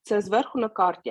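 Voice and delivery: ordinary talk, nothing marked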